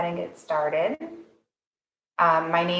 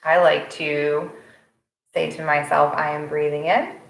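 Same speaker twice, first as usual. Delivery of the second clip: guided meditation. Real, not acted. Instructor settling a morning class into breath awareness, calm guided meditation. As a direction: guide them gently into the breath, unhurried.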